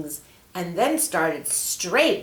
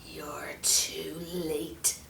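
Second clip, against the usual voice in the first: slow, sinister hissed whisper; snake whisper; 2 semitones lower, 7 dB quieter, much slower than usual. Acted. Snake taunting the angel in a slow whisper. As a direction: hiss it menacingly.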